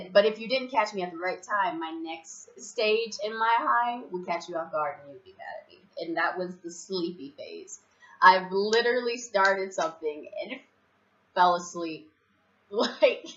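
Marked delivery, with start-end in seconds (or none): none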